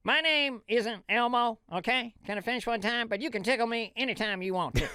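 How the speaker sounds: Ross Perot impression. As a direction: pitch up and drag it like Perot. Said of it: "Ross Perot".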